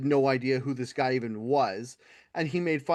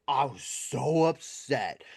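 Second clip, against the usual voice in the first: drawn-out, put-on upset; milk the upset, mockingly slow; mock pearl-clutching; slower than usual. Comedian retelling an offended audience member's complaint, mock pearl-clutching. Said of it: "fucking pearl clutchy about it".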